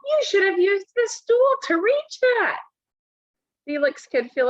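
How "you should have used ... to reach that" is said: scolding mom. Acted. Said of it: "yelled his mom".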